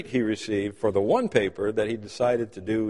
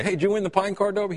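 eager question; 6 semitones higher, faster than usual. Retold or imitated. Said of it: "go find Dylan and say".

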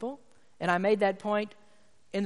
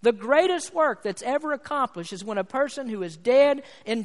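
higher-pitched, emphatic pulpit delivery; impassioned preaching; 5 semitones higher, 7 dB louder than usual. Real, not acted. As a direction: preach it with mounting force, hammering key words. Preaches with force again.

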